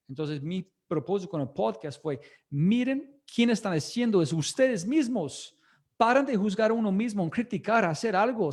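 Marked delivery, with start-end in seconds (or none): 2.5-8.5 higher and louder, urging the listeners on; pep-talk exhortation, pitched up and pushed loud; fired-up rallying call. Retold or imitated. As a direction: rouse the room like a coach at halftime.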